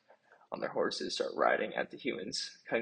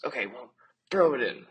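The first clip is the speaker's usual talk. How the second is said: urgent shout; 5 semitones higher, 8 dB louder than usual. Acted. Rabbit urging Willem on.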